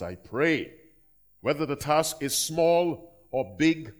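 loud, lifted prayer voice; closing prayer spoken over the people; public prayer. Acted. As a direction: pray over the congregation.

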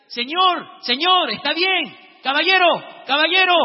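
high, loud calling out; acted shouting. Student shouting to the fallen old man, acted alarmed calling. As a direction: call out to someone you can't see. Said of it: "empiezo a gritarle".

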